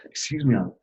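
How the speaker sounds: holding back emotion, halting; getting emotional. Real, not acted. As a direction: excuse yourself as real emotion catches you. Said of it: "getting a little emotional".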